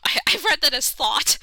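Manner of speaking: laughing through a flub; real giggles. Real, not acted. Player laughing after misreading a word.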